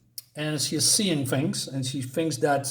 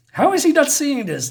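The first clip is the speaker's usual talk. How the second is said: high, loud, incredulous outburst; can't believe he doesn't see it; disbelief; 12 semitones higher, 6 dB louder than usual. Acted. Lucy panics that the cleaner can't see the mold, loud and high.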